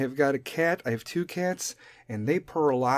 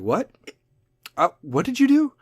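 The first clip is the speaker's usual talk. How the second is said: higher-pitched voice for her "what did you do"; woman's startled reply; 9 semitones higher than usual. Acted. Woman answering a man's confession, startled and higher. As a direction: pitch it up as the suspicious partner.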